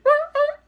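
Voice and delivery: very high, loud mock yelps; piercing dog cry done by voice; imitated dog yelping. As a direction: yelp like a dog giving up. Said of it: "you hear".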